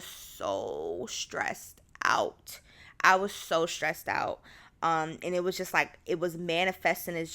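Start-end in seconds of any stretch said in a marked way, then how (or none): 0.0-2.6 very slow, stretching "so" and "out"; drawn-out emphasis. Real, not acted. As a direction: drag out "so stressed out", dropping low. Stresses how stressed she was.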